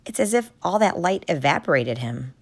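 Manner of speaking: ordinary delivery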